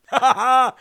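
short loud laugh at his own joke; quick loud laugh after a Biden joke; burst of laughter. Real, not acted.

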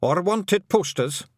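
high, slow Scottish wee man, worried; Feagle voice. A narrator's reading. A Feagle warning Tiffany about documents, high Scottish fret. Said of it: "said another".

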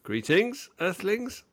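high, sing-song alien greeting. Pretend. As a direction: hail the humans like a spaceman.

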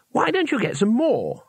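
high, chirpy suggestion; innocently helpful, pitched right up; brightly. A narrator's reading. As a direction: pipe up with a bright idea. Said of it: "said Two Flower brightly".

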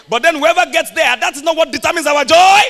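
fiery sermon shout; full-force preaching. Real, not acted. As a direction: declare it to the crowd.

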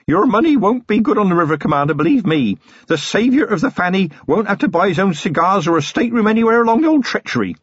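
put-on a keen young constable showing off; Feeney, eager young copper. Young copper offering the commander a stateroom, eager.

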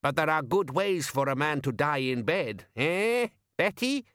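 rising high sly tease; old woman's sly rising tease; teasing old woman. Acted. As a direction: nudge her with a bawdy wink.

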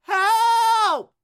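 high, drawn-out shout for help; screaming for help. Acted. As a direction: call out long and high for rescue. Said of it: "stop screaming".

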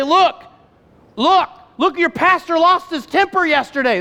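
high, loud mock accuser telling on someone; devil as accuser. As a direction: play an eager tattletale, stretched and insistent. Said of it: "What he's doing is".

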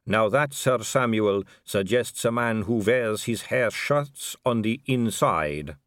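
put-on measured, accented vampire aristocrat; smooth vampire lady. Vampire lady giving Vimes the diplomatic line, smoothly.

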